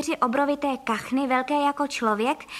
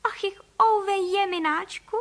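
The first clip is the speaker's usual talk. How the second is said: high, slow wail of woe; dismayed lament; 7 semitones higher than usual. A narrator's reading. Mrs. Frejková upset over the invaded house, dismayed lament. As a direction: moan slowly in a high voice. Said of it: "řekla paní Frejková".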